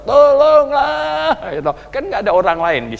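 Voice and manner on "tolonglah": high, loud, very drawn-out cry; shouted plea. Retold or imitated. Man alone in the shower begging God, shouting slowly. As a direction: wail it out loud to heaven. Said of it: "saya teriak-teriak sama Tuhan gitu".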